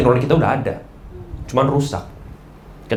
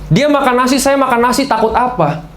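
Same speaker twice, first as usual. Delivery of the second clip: mock bravado, chest puffed out; cocky swagger; 7 semitones higher than usual. Shown as a demonstration. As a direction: throw it out like a brash know-it-all daring anyone. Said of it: "dunia mengajarkan".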